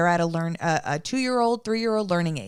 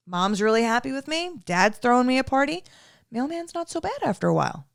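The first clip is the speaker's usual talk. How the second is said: a hint of a cheerful dog, rising higher; happy dog voice; 6 semitones higher than usual. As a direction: lightly voice the dog's contented thoughts.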